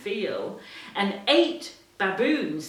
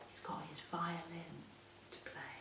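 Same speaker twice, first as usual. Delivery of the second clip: whisper; 3 semitones lower, 20 dB quieter, faster than usual. Real, not acted. Parent whispering to a child about the cricket. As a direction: whisper it like a secret. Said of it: "aside to child about picture".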